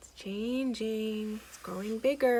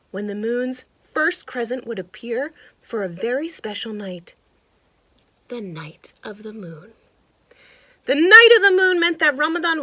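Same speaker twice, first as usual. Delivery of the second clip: lively storybook narration; animated read-aloud for kids; reading to children; 11 dB louder than usual. A narrator's reading. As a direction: read it like a picture book to a child. Reading picture book aloud.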